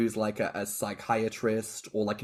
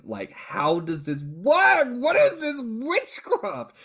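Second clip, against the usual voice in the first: much higher, slow, stretched-out amazement; amazed; 11 semitones higher, 5 dB louder, slower than usual. Retold or imitated. Host retelling amazement at Brian's hypnosis, high and slow. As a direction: marvel at it like magic. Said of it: "being like".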